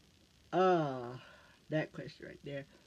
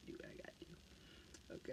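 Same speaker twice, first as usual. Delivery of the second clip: whispered echo; 21 dB quieter than usual. Real, not acted. Repeating her motto to herself, in a whisper. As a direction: whisper it to yourself as an afterthought.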